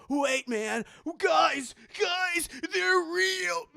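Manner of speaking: high, loud mock panic; horrified realization. Drunk guy warns friends, mock horror. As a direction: cry out as if about to retch.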